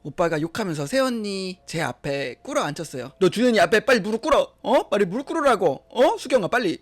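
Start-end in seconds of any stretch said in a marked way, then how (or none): 3.2-6.8 angry command. Acted. Brother forcing wife to kneel.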